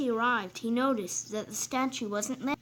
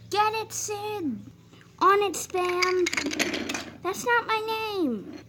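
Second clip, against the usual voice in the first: high, slow cartoon villain voices; villain engines; 10 semitones higher, 4 dB louder, slower than usual. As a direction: ham up the bumbling henchmen.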